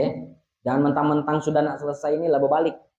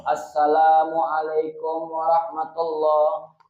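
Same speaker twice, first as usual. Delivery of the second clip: Assalamualaikum warahmatullah drawn out slowly and smoothly; slow perfect salam demo; slower than usual. Shown as a demonstration. Teacher models the perfect prayer salam slowly. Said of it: "Jangan di sini kok".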